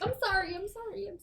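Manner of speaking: high, sobbing apology; snotty crying. Acted. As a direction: blubber the apology out. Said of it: "she's like".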